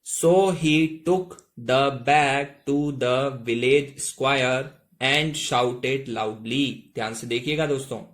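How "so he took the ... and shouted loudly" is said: very slow, word by word English; slow model reading. Shown as a demonstration. Teacher to Hindi learners, reading the next line very slowly. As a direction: spell out the sentence slowly for beginners. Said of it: "आगे देखिएगा".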